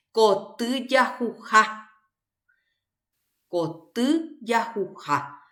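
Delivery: slow, careful reading of "koty jahuha"; teacher reading "koty jahuha" aloud slowly; slow model reading. Shown as a demonstration. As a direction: model "koty jahuha" slowly for the class, every syllable clear. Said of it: "Tiene dos vocal tónica".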